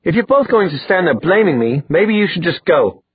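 high-pitched indignant outburst; mildly heated, defensive anger; angry dismissal. Acted. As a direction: tell them off, pitch held high.

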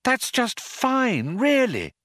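high, slow sing-song; stretched-out, high-pitched soothing; lilting. A narrator's reading. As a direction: reassure in an exaggerated lilt. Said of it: "lilted Trillian".

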